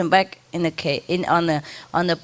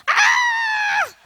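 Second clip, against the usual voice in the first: piercingly high imitated parrot scream; bird scream. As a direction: screech like a bird demanding attention. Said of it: "And then scream".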